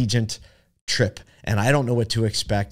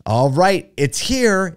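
bright, loud, high PA call; excited announcement; 7 semitones higher, 5 dB louder, much slower than usual. Retold or imitated. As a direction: announce it like big news. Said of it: "in an excited tone".

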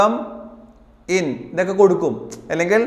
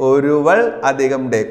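slow dictation; 5 semitones lower, much slower than usual. Shown as a demonstration. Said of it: "teacher dictates the split form".

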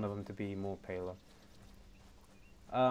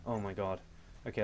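ordinary talk, nothing marked

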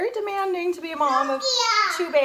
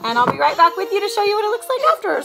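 hurried over crying; 8 semitones higher, 9 dB louder, faster than usual. Real, not acted. Mom rushing her sign-off over a crying baby, loud. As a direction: hurry the sign-off loudly.

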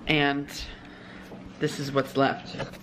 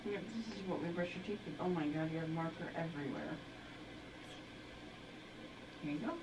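hushed; 2 semitones lower, 18 dB quieter, faster than usual. Real, not acted. Hushed bedtime tooth brushing.

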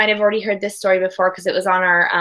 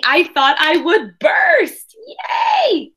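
bursting with joy; 8 semitones higher, slower than usual. Acted. Boy picked for show and tell squeals with joy. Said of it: "Oh, I was so happy".

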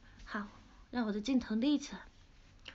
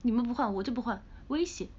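ordinary delivery

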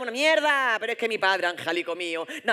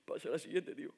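put-on teen boy crying; 6 semitones lower, 14 dB quieter than usual. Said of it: "en esa escena de amor".

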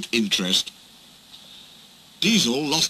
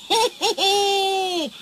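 high, slow hearty ho-ho-ho; chuckling; much slower than usual. A narrator's reading. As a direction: chuckle slowly at Diesel's mishap. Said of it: "chuckled Duck".

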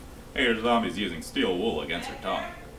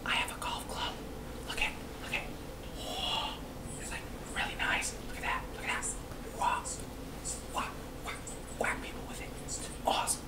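whispering; 11 dB quieter than usual. Real, not acted. Secret showing off golf club.

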